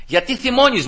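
high-pitched repeated challenge; insistent question. Retold or imitated. The Lord's question echoed after the protest, insistent challenge.

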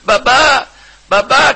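put-on high, loud calling of a small child; child crying out. Preacher voicing his small daughter calling through the door. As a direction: cry out for papa and mama like a little girl.

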